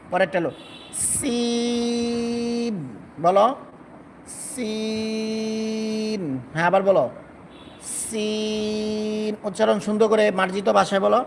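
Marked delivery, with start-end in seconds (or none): 1.1-2.8 সিন with a whistling s and long level ee; long sin. Shown as a demonstration. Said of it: "লম্বা হবে". 4.5-6.2 সিন with a hissed s and very long ee; long sin. Shown as a demonstration. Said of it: "লম্বা হবে". 8.0-9.3 সিন with a whistling s and long level ee; long sin. Shown as a demonstration. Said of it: "লম্বা হবে".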